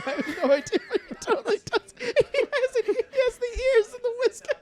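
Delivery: high, squeaky laughter through words; laughing hard. Real, not acted. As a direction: talk while cracking up. Laughing at cat filter video.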